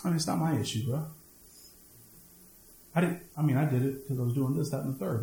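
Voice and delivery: lightly voiced shrugging excuse; making excuses. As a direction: mumble a lazy excuse. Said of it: "have the audacity to".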